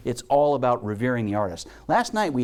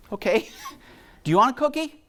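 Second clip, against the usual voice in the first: higher, laughing, quicker; laughing, playfully indulgent; high laughing reply to the boy; amused question; 11 semitones higher than usual. Retold or imitated.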